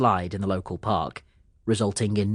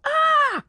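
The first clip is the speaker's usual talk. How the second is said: very high, louder startled "Ah!"; short yell; 5 dB louder than usual. A narrator's reading. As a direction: yelp as you fall. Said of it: "He barely had time to yell".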